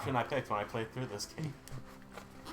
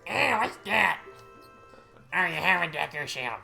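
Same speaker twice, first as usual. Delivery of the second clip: high, loud mocking kid; bratty kid voice; 7 semitones higher, 7 dB louder than usual. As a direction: taunt like a jealous brat. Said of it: "Do your best voice acting".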